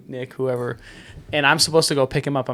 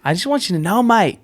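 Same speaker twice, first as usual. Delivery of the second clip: higher-pitched, badly faked Australian accent; bad Aussie accent; 5 semitones higher than usual. Shown as a demonstration. Friend attempts Muzz's Aussie accent on request, badly faked. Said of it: "Do the Aussie accent".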